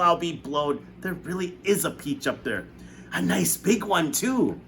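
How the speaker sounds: high, loud, mocking then astonished; scoff, then gape; aunt doubting, then seeing the peach; scornful aunt turning amazed. A narrator's reading. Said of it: "Aunt Spiker said".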